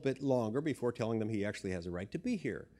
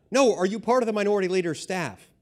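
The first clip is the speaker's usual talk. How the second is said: imitated guard, loud and high, insistent; impatient demand; 7 semitones higher, 10 dB louder than usual. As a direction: cut in with an exasperated, loud correction. Guard losing patience, retold.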